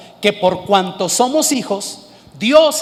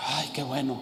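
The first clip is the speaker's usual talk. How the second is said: relieved whisper; 8 semitones lower, 14 dB quieter than usual. Retold or imitated. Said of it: "como que dijo".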